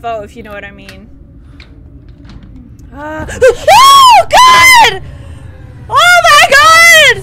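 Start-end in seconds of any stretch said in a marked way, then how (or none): 2.9-7.2 shrieking in real fright, high and loud; terrified scream. Real, not acted. Streamer shrieks at the villain's jump scare in terror. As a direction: scream as the monster lunges.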